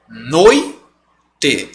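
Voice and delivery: Noite as "noi... te"; two slow beats for Noite, oi in one; syllabified pronunciation. Shown as a demonstration. Teacher asking how it's pronounced, Noite split noi... te. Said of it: "Como é que você pronuncia?".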